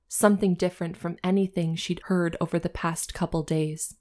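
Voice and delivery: ordinary talk, nothing marked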